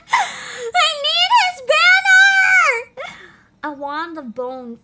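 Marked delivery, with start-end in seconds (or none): 0.1-3.1 high, slow, laughing shout; excited scream. Real, not acted. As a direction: wail it with longing. Fangirling over character.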